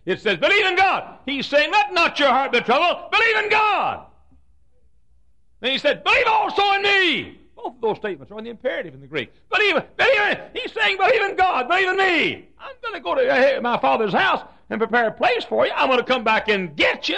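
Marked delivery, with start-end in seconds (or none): 0.4-7.4 "Believe" hit high and loud as a command; imperative command. Shown as a demonstration. Preacher showing the congregation the imperative, commanding. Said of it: "is an imperative". 9.5-17.2 high, loud preaching cry. Acted. Preacher pressing Jesus' command on the congregation, full force.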